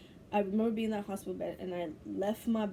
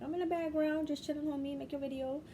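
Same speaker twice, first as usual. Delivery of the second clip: higher, casual God voice; laid-back God; 7 semitones higher than usual. Retold or imitated. God teasing the vlogger, laid-back and higher. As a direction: play God lounging in the background. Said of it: "He's like".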